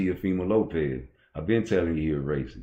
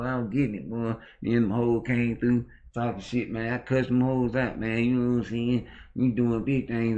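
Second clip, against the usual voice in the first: nineties street hustler; 4 semitones higher than usual. Pretend. Nineties hustler bragging to his boys about a gun, higher voice. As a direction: play a nineties corner boy showing off his gun. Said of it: "talk like I talked to them boys in the nineties".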